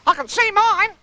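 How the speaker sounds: very high, excited little squeal; high-pitched childlike cry; Roo. Acted. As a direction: cry out in a loud, tiny high voice. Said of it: "cried Roo".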